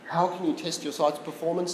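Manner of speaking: ordinary talk, nothing marked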